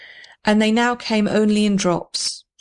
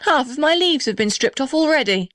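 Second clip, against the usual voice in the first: high, raised shout; 7 semitones higher than usual. Acted. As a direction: cry out over the storm. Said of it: "shouted the blue gum".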